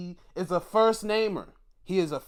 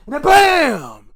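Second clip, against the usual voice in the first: a sudden much louder, higher burst; excited shout; 12 semitones higher, 18 dB louder, slower than usual. Real, not acted. Streamer calling the knockout blow to his viewers, excited shout. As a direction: slam the word out like the punch landing.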